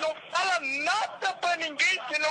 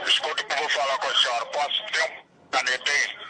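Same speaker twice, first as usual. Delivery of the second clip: much lower, gruff-pitched man's threat; menacing kidnapper; 11 semitones lower than usual. Acted. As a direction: play the kidnapper taking the phone. Caller switches to kidnapper voice.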